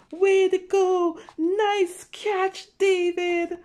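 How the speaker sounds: high, loud slow big-brother cheer; proud cheer. Acted. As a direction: shout praise to your little brother. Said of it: "his brother is like".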